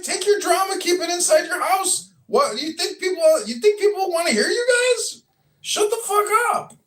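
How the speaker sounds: high-pitched exasperated telling-off; angry rant. Acted. Acted rant at the noisy couple.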